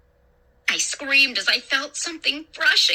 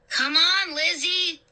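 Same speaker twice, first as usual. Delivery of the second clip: higher-pitched coaxing call; raised pitch, urging someone along; 6 semitones higher than usual. A narrator's reading. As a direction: call a friend over. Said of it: "Luke called".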